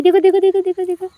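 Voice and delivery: high, loud, thrilled; excited. Real, not acted. Girl watches the cat dash, high excited cries. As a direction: cheer the cat on.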